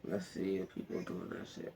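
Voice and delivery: whispered mutter under the breath; whisper to self. Real, not acted. Viewer searching online, whispering to himself. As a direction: breathe it to yourself while searching.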